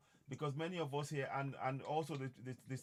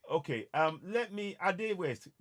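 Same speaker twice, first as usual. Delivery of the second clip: to someone hard of hearing; 4 semitones higher, 7 dB louder, slower than usual. Real, not acted. Calling in caller, bad network.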